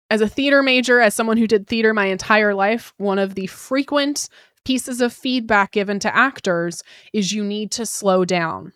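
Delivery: ordinary talk, nothing marked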